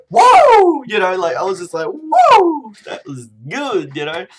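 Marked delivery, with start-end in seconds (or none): 0.1-0.8 high, loud amazed whoa; yelled whoa of amazement; excited whoa. Shown as a demonstration. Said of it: "That was a really bad woo". 1.9-2.7 high, loud amazed whoa; yelled whoa of amazement; excited whoa. Shown as a demonstration. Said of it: "That was a really bad woo".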